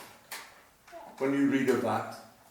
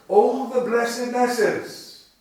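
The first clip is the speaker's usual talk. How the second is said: loud, slow exclamation, higher; 7 semitones higher, 7 dB louder, slower than usual. Shown as a demonstration. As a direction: proclaim it like a cry of wonder. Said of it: "it would have had this".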